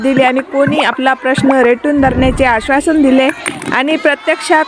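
loud, energetic reporter's sign-off; news report. Acted. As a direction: project it like a field reporter.

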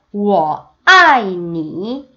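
very slow, syllable by syllable; slow teacher demo; slow model reading. Shown as a demonstration. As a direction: spell out 你很漂亮 slowly. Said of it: "나는 중국어로".

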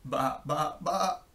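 three baas, rising; sheep bleats. A narrator's reading. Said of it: "said the fat red hen".

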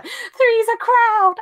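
hammed-up heartbroken schoolgirl; overdramatic wail. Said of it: "Over dramatic".

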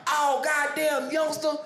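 high, slow drawn-out complaint; mock pained cry. Champ hit with a bottle, wailing high and slow.

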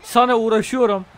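loud, high exasperated complaint; loud annoyed rant at the game; frustrated yell. Real, not acted.

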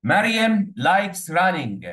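very slow, word by word, loud; dictating slowly to pupils; slow dictation. Shown as a demonstration. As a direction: spell it out for the class to write. Teacher dictating a sentence.

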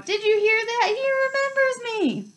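very high, overjoyed squeal; thrilled cry. A narrator's reading. Excited fan telling everyone around he's remembered, thrilled cry. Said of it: "cried Douglas Diggle, looking around at everyone".

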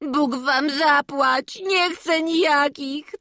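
slow, drawn-out tearful wail; acted sobbing. A narrator's reading. Boy refuses master's new shoes, sobbing. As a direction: blubber out the refusal like a child. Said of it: "zanosił się od płaczu".

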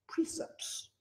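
unvoiced whisper, very soft; a joking stage whisper about precepts. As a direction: hush it like a secret. Mock secret about precepts.

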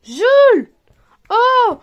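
sharp loud call of a name; loud warning call; stern call. Real, not acted. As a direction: call him to order, loud. Mother scolding crying child.

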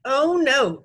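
loud, high refusal; shouted. A narrator's reading. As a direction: shout it as a flat-out veto. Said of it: "Jimmy shouted".

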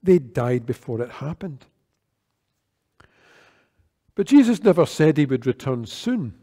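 ordinary delivery

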